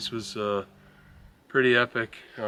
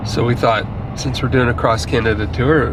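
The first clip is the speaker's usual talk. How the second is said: travel vlog narration; 5 dB louder than usual. Acted.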